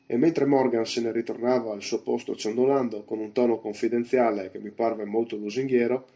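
plain reading voice

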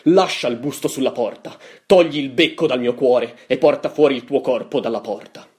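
commanding theatrical cry; dramatic shout. A narrator's reading. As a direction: order it out with full force. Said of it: "gridai alzandomi all'impiedi".

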